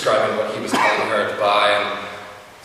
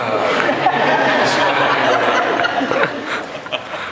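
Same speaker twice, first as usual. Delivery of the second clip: breathy laugh, rising; fond chuckle at the memory; 3 semitones higher, 5 dB louder than usual. Real, not acted. Laughs again at Kate.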